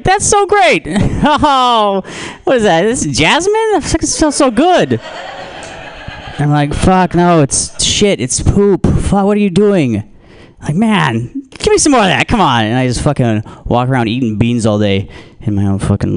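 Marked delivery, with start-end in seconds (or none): 0.0-0.8 much higher, delighted gush; squealing with mock delight; gushing fan. Audience voiced gushing over smell. 0.9-5.0 much higher, delighted gush; squealing with mock delight; gushing fan. Audience voiced admiring the fart. 6.7-10.0 higher, incredulous protest; mock exasperated. He protests his own smell. 10.7-12.6 higher, eager craving; mock eager; greedy fan. Audience voiced craving more.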